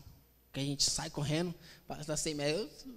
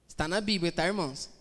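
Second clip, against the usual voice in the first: ordinary delivery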